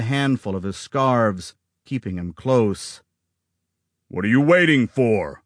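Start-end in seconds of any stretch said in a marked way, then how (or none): 4.1-5.4 demanding. A narrator's reading. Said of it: "Augustus demanded".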